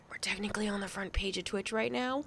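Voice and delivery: hushed whisper. Real, not acted. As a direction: whisper it like a secret. Whispered aside about front page.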